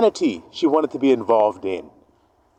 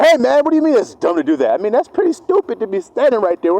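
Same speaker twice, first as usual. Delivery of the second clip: indignant fool; 7 semitones higher, 3 dB louder than usual. Retold or imitated. Fool argues back.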